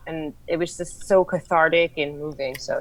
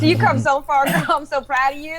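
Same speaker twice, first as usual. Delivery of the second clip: loud, high-pitched cheer; gushing praise; 10 semitones higher, 4 dB louder than usual. Pretend. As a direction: gush proudly, high and loud, like a fan.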